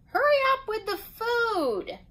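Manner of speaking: high-pitched impatient call; hollering. A narrator's reading. Bridget to Zach about her meatloaf, hollering. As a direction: holler it up high to the other room. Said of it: "Then she hollered to Zach".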